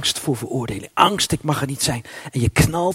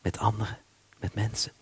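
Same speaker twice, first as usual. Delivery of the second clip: low and much softer, fading out; hushed, lower plea for connection; despairing small voice; 7 semitones lower, 10 dB quieter than usual. Acted. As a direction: let the despair sink almost to nothing. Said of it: "een diepe wanhoop die zegt".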